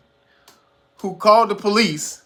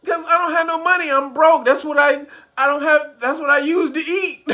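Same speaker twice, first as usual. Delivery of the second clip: laughing quote; 6 semitones higher than usual. Retold or imitated. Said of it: "She said".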